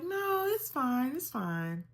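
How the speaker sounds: high, stretched denial dropping back down; mock-breezy insistence, starting very high; dismissive reassurance. Laboring woman waving off her sister's prediction, high-pitched reassurance.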